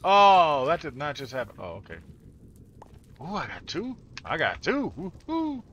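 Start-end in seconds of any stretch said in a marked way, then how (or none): 0.1-1.6 loud shocked gasp, then disbelief; shocked laughter. Real, not acted. Shocked at game event. 3.2-5.6 high gleeful whoop; excited cheer. Real, not acted. Excited at getting loot.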